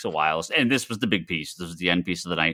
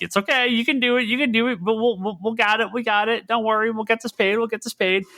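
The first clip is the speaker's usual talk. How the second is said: much higher, coaxing friend voice; encouraging buddies; 12 semitones higher than usual. Retold or imitated. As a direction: coax him on, pitched up high. Buddies urging him to bid.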